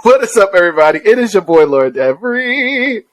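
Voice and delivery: loud, upbeat host greeting; podcast intro. Acted. Host opens the episode for listeners, upbeat. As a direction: hype up the show opening.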